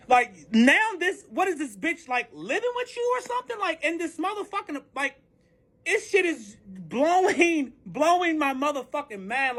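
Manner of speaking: high-pitched, incredulous rant; exasperated disbelief. Retold or imitated. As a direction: let the pitch climb in outraged disbelief. Ranting in disbelief about the affair.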